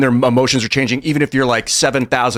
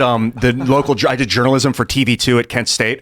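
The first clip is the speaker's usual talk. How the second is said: normal talking voice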